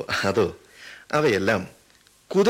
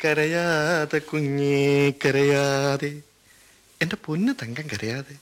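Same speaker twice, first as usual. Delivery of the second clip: tender comfort; much slower than usual. Acted. Old soldier comforting the crying prisoner, tender and slow. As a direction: hush a crying child, very slowly.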